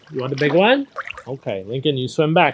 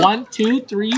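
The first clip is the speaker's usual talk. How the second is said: counting out loud; 10 semitones higher, 8 dB louder, slower than usual. Real, not acted. Coach counting for child underwater.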